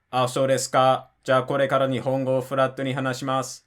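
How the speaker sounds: "Ah, so desu ka" held on one unmoving pitch; ridiculously flat Japanese. Retold or imitated. Retelling the skit where he speaks actually flat, mock monotone Japanese. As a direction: say "Ah, so desu ka" in a deliberate monotone, no rise or fall. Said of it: "starts speaking actually flat, and it sounds ridiculous".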